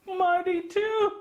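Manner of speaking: plain reading voice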